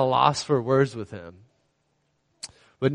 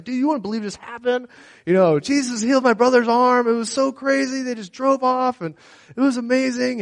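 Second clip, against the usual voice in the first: high, fast, bubbling excitement; hyped phone call; 12 semitones higher, 3 dB louder than usual. Retold or imitated. Brother calling friends about the healing, very excited. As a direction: spill the news to friends.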